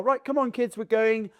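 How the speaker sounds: put-on high, brisk call to the children; rally the kids to follow you; acted parent announcing it's time to go; imitating parent.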